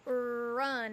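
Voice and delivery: ordinary delivery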